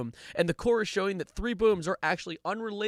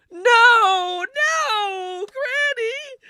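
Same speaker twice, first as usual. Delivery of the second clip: high wailing fake sobs; melodramatic mock grief; 9 dB louder, much slower than usual. Comic acts out losing granny, melodramatic wailing. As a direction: wail it out, loud then fading. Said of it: "drama practice".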